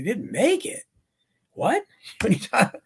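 high-pitched retold incredulity; acted-out "what?!" disbelief; shocked disbelief. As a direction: imitate someone stunned by bad news, pitch leaping up. Retold shocked reaction to doctor's news.

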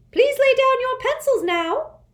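high, loud prim official voice; a strict, shrill-free schoolmistress; stern test administrator. A narrator's reading.

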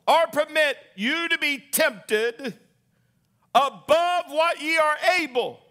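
high, loud, slow declarations of faith; fervent revival preaching; full-force preaching. Acted. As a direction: proclaim it over the congregation.

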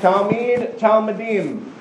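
"Talmid, talmidim" said clearly, stress on the last syllables; teacher modelling "Talmid, talmidim"; modelled Hebrew words. Shown as a demonstration. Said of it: "Say talmidim".

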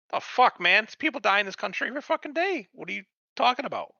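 high, mocking scoff; scoffing retort. Mocked Batman scoffs at the pearl-clutching Superman.

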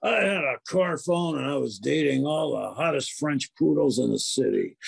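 far Rolf the dog voice. Acted. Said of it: "is Rolf".

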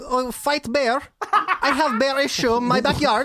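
high, laughing mock Slavic accent; broken English in a loud comic Eastern European accent; hammed-up foreigner, pitched high; Russian-style accent. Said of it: "What are you, Borat?".

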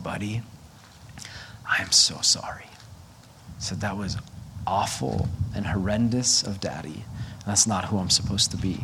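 soft, hushed apology, near a whisper; tearful apology. Retold or imitated. Father sitting on his son's bed, apologizing softly in tears. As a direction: whisper sorry to your son with tears.

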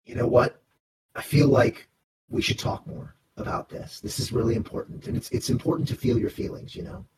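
hushed in-character confiding; whisper. Acted. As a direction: whisper it gently, just between two crewmates. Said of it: "and whisper to you".

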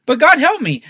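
impassioned appeal, voice lifted; crying out to God. Acted. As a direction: plead earnestly for God's help. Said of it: "You see how I'm crying out for God's help".

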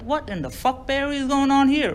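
high-pitched incredulous demand; high, mock-outraged questioning; appalled uncle. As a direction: act out an uncle demanding an explanation.

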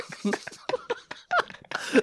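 breathless silent laugh; high wheezing laugh. Real, not acted. Cracking up.

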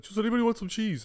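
high, loud mock offer to the group; imitated cheese offer. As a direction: call it out high, imitating your mate. Friend offering hidden cheese around.

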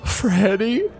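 tearful call. Acted. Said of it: "And he goes".

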